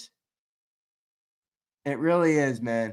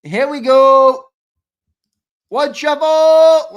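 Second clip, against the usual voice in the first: drawn-out hype call, much louder and higher; slow booming announcer call; 8 semitones higher, 12 dB louder, much slower than usual. Real, not acted. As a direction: build the hype like a ring announcer. Hyping up a card break.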